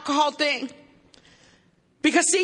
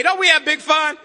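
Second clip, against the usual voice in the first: normal talking voice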